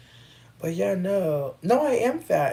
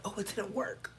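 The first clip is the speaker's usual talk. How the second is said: soft, airy fake-sweet reply; mock innocent; 3 semitones higher, 7 dB quieter than usual. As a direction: play dumb sweetly. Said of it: "so I can be like".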